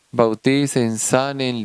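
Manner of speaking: ordinary delivery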